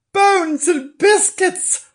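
very high, drawn-out exclamation; amazed cry. Acted. Nobbs amazed at the hiding place, high cry. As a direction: exclaim slowly and high, astonished. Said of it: "cried Nobbs".

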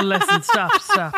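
high playful plea to stop; jokey embarrassed stop stop; mock protest. Real, not acted. Joking protest at teasing plug.

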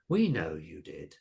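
slow, low monster reassurance; reassuring. A narrator's reading. Monster accepting Carolina's apology, reassuring. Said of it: "said Missus Cabbage reassuringly".

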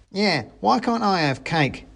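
high-voiced little grumble; complaining mouse soldier. A narrator's reading. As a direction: protest being left out, as the mouse soldier.

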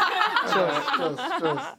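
laughing through the words; giggling agreement, high. Real, not acted.

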